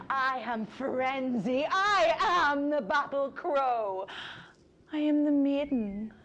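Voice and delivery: high, loud rising battle shriek; frenzied cry. Acted. The war goddess naming herself battle crow, crying out in frenzy. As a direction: let the frenzy break loose.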